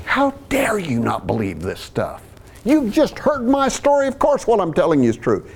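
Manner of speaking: high-pitched indignant protest, acted; mock angry outburst. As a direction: act out an exploding, offended preacher. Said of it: "He doesn't explode in anger".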